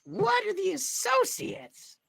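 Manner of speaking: very high, loud squeaky voice; a flirty cartoon critter; Rocky voice. Rocky voice continues.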